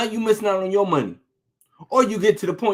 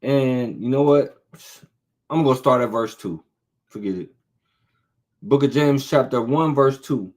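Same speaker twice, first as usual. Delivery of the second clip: ordinary talk, nothing marked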